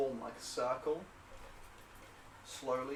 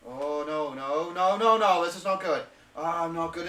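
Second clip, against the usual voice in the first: no's climbing louder and higher; mounting alarm; 4 semitones higher, 10 dB louder than usual. Acted. Man reading his thrown runes, mounting loud alarm. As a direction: let the dismay build with each no. Figure panics over bad rune reading.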